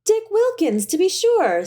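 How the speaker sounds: high, delighted exclamation; delighted recognition. A narrator's reading. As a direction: greet the memory with sudden joy. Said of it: "said Scrooge to the ghost".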